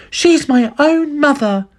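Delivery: high, slow indignant woman; protesting mother. A narrator's reading. Missus Cranky defending her own mother, indignant.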